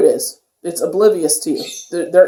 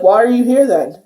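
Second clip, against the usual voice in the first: mock challenge, pitched higher; challenging retort; 5 semitones higher, 3 dB louder than usual. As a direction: imitate someone sassily confronting a heckler. Retelling Snooki telling off a heckler.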